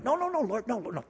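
put-on a child begging to keep its own plan; pleading protest. Believer protesting God's changed plan.

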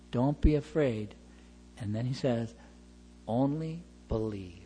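calm a grieving father; hushed gentle comfort; tender reassurance. Retold or imitated.

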